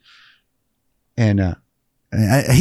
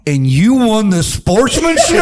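mock disbelief; 11 semitones higher, 3 dB louder than usual. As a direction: rib a friend over the phone. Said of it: "we all called him and go".

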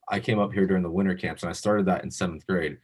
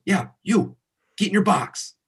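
mock coach yell; 11 semitones higher than usual. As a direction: imitate the coach snapping an order. Retold coach confrontation.

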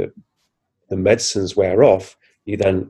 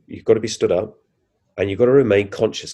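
normal talking voice

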